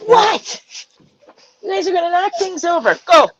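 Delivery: sudden high what, then fast scolding; startled yell at the cats. Real, not acted. Scolding the pets.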